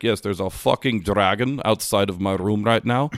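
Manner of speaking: imitating a Scottish friend on the phone; mock Scottish hotel guest reporting trouble; Scottish accent. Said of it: "he's from Scotland originally".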